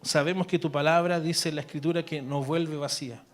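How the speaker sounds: earnest prayer voice, addressed to God; spoken prayer. Acted. Pastor praying over the congregation, earnest prayer. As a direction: pray it aloud, intimate and steady.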